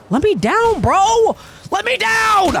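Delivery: frantic yell. Real, not acted. Grabbed by a game boss.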